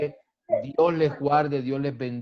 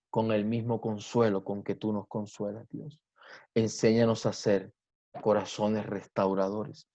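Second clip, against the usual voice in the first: prayer; 4 semitones lower than usual. Acted. Pastor prays aloud to God.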